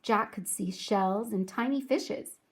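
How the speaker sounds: usual speaking voice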